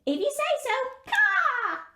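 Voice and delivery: high, squawky bird voice with caws; a cheerful raven chattering; talking raven. Acted. Raven agrees.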